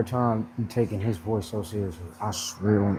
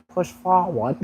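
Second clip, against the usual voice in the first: higher and louder Southern coach; imitating a Southern drawl; 8 semitones higher, 5 dB louder, slower than usual. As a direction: ham up the drawl, pushing you on. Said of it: "in your ear just saying".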